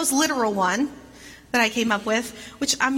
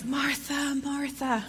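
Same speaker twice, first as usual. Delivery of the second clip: much softer, gentle repeated name; caring and tender; 2 semitones higher, 7 dB quieter, slower than usual. Shown as a demonstration. Jesus calms overwhelmed Martha, soft and tender. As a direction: soothe her with her name. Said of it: "He's caring for her in this response".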